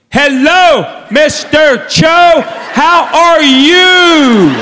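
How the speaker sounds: loud, high, drawn-out greeting to a foreigner; slow yelling. Retold or imitated. Mother-in-law greeting a dad she thinks speaks Korean, slow yelling. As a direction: yell each word slowly as if they barely understand. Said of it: "She still yells at the man".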